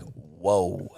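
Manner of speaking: retold dazed reaction; stunned. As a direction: let the shock sink in quietly. Stunned after being hung up on.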